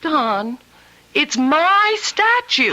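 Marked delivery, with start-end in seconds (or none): 1.2-2.7 insistent. Acted. Wife insisting in argument.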